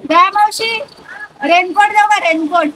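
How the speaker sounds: high, loud call to someone away; real high-pitched shout to aunt. Real, not acted. Calling aunt, offering a raincoat.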